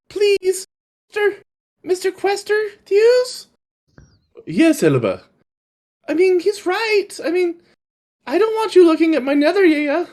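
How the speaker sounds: put-on high, sweet little girl voice; a cute small girl pleading with the wizard; little girl Elba. Little girl Elba asking the wizard, in a high sweet voice. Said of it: "Elba speaks up".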